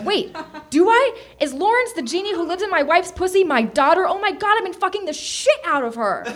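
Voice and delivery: high, mounting acted panic; horrified realisation. A narrator's reading. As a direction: blurt it out in mock horror.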